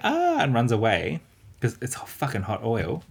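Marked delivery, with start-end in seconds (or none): 0.0-0.4 mock scream. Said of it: "everyone's like".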